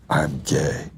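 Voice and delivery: low, hushed confession. Retold or imitated. Retelling a coworker's coming out, low and hushed. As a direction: drop the voice low, like admitting a secret. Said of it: "And he goes".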